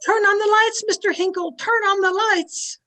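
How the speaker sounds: alarmed shout. Acted. Medium orders the lights on after a scream, alarmed shout. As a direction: call out in alarm across the dark room.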